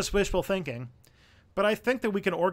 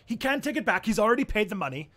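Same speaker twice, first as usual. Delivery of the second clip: ordinary talk, nothing marked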